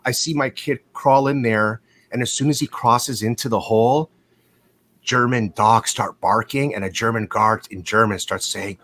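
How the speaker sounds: ordinary delivery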